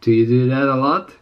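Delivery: normal talking voice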